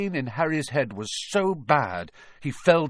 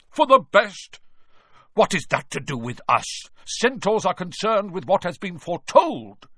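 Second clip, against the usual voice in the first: angry; 6 semitones higher, 5 dB louder than usual. Acted. Bane kicks in anger.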